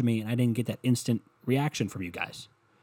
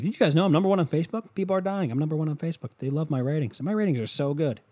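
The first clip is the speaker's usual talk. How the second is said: high, fast mocking president voice; mocking Trump impression; 5 semitones higher than usual. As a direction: ham it up as a bragging president. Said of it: "He's like".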